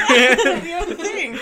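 loud, slow, laughing repeat; laughing loudly. Real, not acted. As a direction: burst out laughing through the words.

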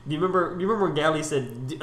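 ordinary talk

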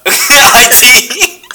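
laughing while answering. Real, not acted. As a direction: burst out laughing on the words. Laughing answer to a silly question.

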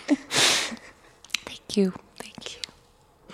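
choked-up whispered thanks; tearful and moved, barely voiced; teary. Real, not acted. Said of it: "And now I'm crying".

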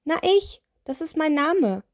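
high, perky little witch. Acted. Witch tells the giant her name, high little voice.

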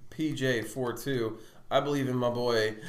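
usual speaking voice

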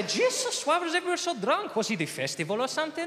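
Spanish friend. Acted. Spanish friends asking about London, high accented surprise. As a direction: exclaim in shock with Spanish accent.

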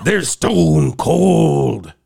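slow, drawn-out wrestler promo; wrestling promo voice. Acted. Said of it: "you gotta say that in a wrestling promo".

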